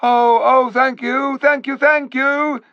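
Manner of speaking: high, loud overjoyed cries; eager thanks. A narrator's reading. The crowd of beasts thanking the ape, high and eager. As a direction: gush with relief. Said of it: "said dozens of voices".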